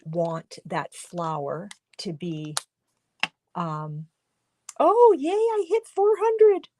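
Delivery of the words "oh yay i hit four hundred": sudden high, loud delighted cry; real thrilled squeal; excited. Real, not acted. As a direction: burst out with joy at the milestone. Hitting four hundred viewers.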